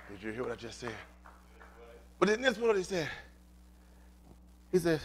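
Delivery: usual speaking voice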